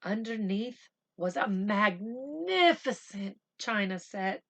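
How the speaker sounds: ordinary talk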